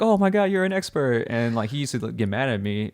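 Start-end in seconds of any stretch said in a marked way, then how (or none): 0.0-1.3 impressed customer. Retold or imitated. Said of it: "they're like".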